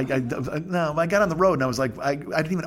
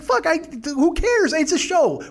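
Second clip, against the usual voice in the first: much higher, mock-defensive outburst; exasperated protest; 11 semitones higher than usual. Answering girlfriend about the show, exasperated protest. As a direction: brush it off in a high, exasperated voice.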